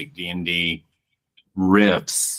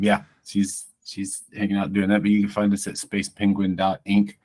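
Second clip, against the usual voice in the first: ordinary delivery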